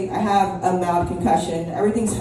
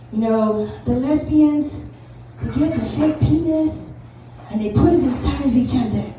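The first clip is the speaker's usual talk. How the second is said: mock preacher; 4 semitones higher than usual. Comic imitating visiting pastor's homophobic sermon. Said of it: "he said".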